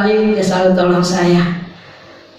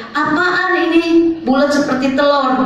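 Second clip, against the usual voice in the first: retold surprise; 6 semitones higher than usual. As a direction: imitate the masseur's startled discovery. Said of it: "dia kaget". (menirukan tukang pijit yang kaget)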